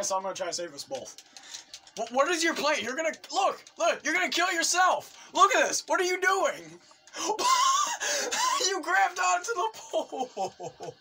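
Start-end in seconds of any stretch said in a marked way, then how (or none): 2.0-6.6 high, loud, incredulous questioning; frantic protest. Real, not acted. Player scolding a partner about to die, frantic. 7.1-8.6 squealing burst of laughter; helpless high laughter. Real, not acted. Player cracking up at a partner's blunder, squealing laugh. 8.7-10.9 high, drawn-out squeal through laughter; laughing disbelief. Real, not acted. Player mocking a partner who grabbed the pole, laughing.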